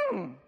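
short wordless closed-mouth "mm"; stuffer's grunt. Retold or imitated. Daughter refusing to share emotions, tight little grunt. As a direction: grunt instead of answering. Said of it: "she'll just respond, and she'll just go".

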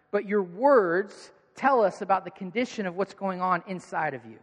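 ordinary delivery

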